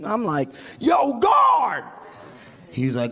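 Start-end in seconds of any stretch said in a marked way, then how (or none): 0.8-1.8 high, acted holler for the guard; mock jailbird yell; calling out. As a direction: call across the cell block. Acts calling out from jail cell.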